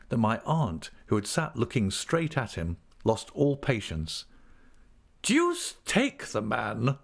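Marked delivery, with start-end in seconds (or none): 5.2-7.0 slow, emphatic, raised pitch; stern. A narrator's reading. Aunt loses all patience with the clerk, stern. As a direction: curse him sternly, stretching the words. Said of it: "said my aunt sternly".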